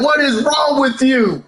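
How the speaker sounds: high mock yell in a put-on Heyman voice; imitating Paul Heyman yelling. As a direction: lightly impersonate an angry manager scolding. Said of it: "Paul Heyman was yelling at Seth Rollins".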